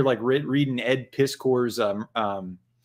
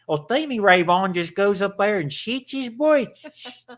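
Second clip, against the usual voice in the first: higher put-on cartoon-kid voice; South Park voice; 7 semitones higher than usual. Host imitates South Park gag, higher cartoon voice. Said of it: "he's like South Park".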